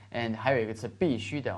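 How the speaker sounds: ordinary talk, nothing marked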